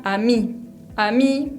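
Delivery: AMI stopping right on the I, "a-MEE"; silent final E. Shown as a demonstration. Teacher showing "amie" to learners, final E silent. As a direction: end "ami" at the I with nothing after. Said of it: "pronuncio hasta la I nomás".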